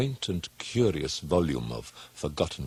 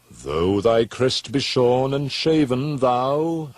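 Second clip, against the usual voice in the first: higher, louder, declaiming; grand address; 8 semitones higher, 8 dB louder, slower than usual. Acted. Addressing the raven, a loud grand declamation. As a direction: proclaim it to the bird.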